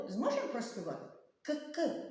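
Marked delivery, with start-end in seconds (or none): 1.4-2.1 «К-к» in two short bursts; quick detached «К-к», impossible to sing; abrupt consonant. Shown as a demonstration. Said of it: "Не зможемо проспівати". (уривчасте, коротке «К-к»)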